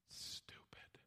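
whispered dismissal of the doubter; scornful whisper. Acted. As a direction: whisper it as a private put-down. Doubter mutters scorn under breath.

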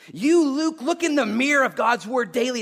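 high, loud preacher's exhortation; preaching at full force. Real, not acted. Preacher urging the congregation to self-examination, full force. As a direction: belt it out to the congregation.

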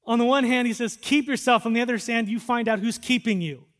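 loud, high, urgent scripture preaching; full-force preaching. Acted. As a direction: press the tension of scripture on them.

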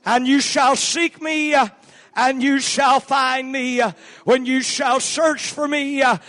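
loud, high, slow scripture proclamation; preaching at full force. Acted. Preacher proclaiming God's promise, loud and drawn out. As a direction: proclaim it to the whole church.

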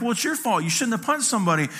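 snappy parental blame; scolding dad. Retold or imitated. Dad answering his crying son, scolding.

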